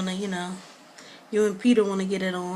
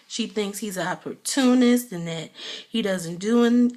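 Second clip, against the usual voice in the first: normal talking voice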